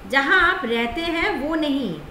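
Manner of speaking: ordinary talk, nothing marked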